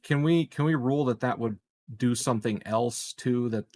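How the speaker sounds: usual speaking voice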